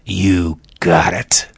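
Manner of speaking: slow, menacing whisper; slow Satan whisper. Retold or imitated. Satan sealing the deal with the Son of God, a slow whisper. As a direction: drag it out in a threatening whisper. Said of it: "can't you almost hear Satan saying".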